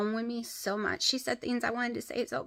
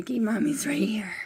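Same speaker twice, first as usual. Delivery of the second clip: whisper. Real, not acted. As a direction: hush it to the pet close up. Soothing pet.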